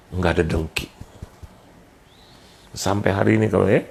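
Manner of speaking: ordinary delivery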